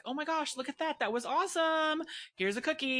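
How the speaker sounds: high, gushing praise for a dog; really positive. Retold or imitated. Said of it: "so we made it really positive".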